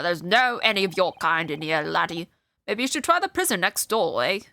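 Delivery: a hint of a dismissive Scottish brogue; lightly Scottish, brushing off the visitor; Scottish accent. Governor sending the Japanese lawyer away, light Scottish accent. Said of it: "Irish or Scottish or something".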